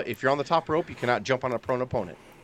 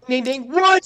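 mock bell and surprise; 11 semitones higher, 5 dB louder, slower than usual.

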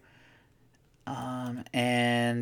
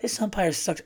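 imitating son; 7 semitones higher, 4 dB quieter than usual. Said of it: "I think he's like".

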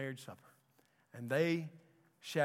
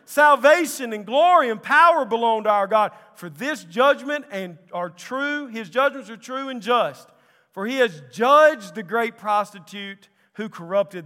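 very loud, high shout of praise; crying out; 8 semitones higher, 17 dB louder than usual. Acted. Great multitude in heaven, crying out Hallelujah. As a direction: burst out at full force, then keep proclaiming. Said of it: "great multitude in heaven crying out".

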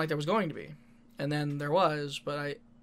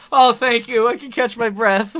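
relieved; 5 semitones higher, 11 dB louder than usual. Retold or imitated. Imitating relieved partner.

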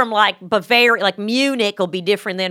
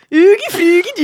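high, loud mock-Swedish gibberish; Swedish Chef; 10 semitones higher, 7 dB louder than usual. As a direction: babble in a comic Swedish sing-song. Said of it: "It's like the Swedish chef".

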